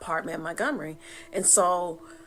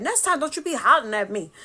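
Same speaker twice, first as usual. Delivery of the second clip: high, louder sassy retort; talking back; 7 semitones higher, 6 dB louder than usual. Retold or imitated. Answering dead sister, sassy loud retort. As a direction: fire it right back.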